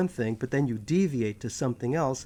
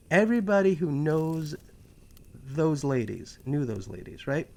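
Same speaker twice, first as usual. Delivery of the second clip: normal talking voice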